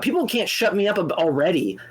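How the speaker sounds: ordinary talk, nothing marked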